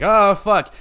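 high, loud "Oh, fuck."; startled curse. Retold or imitated. Player hearing noises in a bathroom, startled loud curse.

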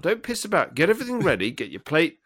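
normal talking voice

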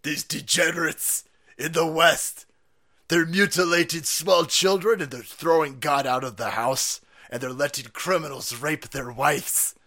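slow, deliberate mock Russian accent; heavy Russian accent, rising to loud pride; Vladimir Putin. Putin judging the West, slow mock Russian accent. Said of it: "see Putin sitting there going".

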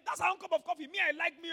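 ordinary talk

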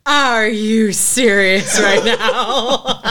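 real amused disbelief, drawn out; incredulous laughing. Real, not acted. Player reacts to absurd voice.

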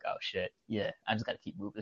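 subdued retreat. Retold or imitated. Walker backing off from laughing kids, subdued. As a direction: back down under your breath.

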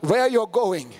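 rousing, rhythmic prophetic declaration; preaching at full force. Real, not acted. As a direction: drive each repeated promise home to the congregation.

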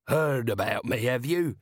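jovial, hearty trader. Acted. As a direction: sound pleased to be recognised. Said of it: "said the trader jovially".

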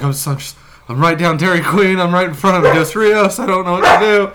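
retelling telling mom where he crashed, panicking; rising panic. Retold or imitated. Panicked retold call, rising.

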